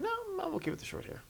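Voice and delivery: high, fast, soft self-quote; high quick aside. Retold or imitated. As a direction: toss it off lightly in a high voice. Quoting own thought last night.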